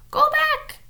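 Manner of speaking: high, loud parrot squawk. A narrator's reading. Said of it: "squawked Polly".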